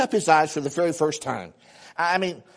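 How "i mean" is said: ordinary delivery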